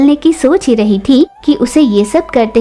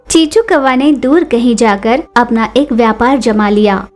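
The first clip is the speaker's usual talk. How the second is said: ordinary delivery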